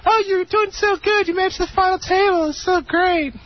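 gushing groupie voice. Retold or imitated. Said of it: "Yeah, they're".

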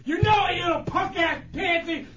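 high, shouting rant, daring him; ranting and raving. Real, not acted. Chunks daring the prankster to come down, shouting in a high rant. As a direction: yell the challenge, barely holding back swears. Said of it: "All that ranting and raving".

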